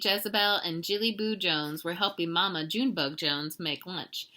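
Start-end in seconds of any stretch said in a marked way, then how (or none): none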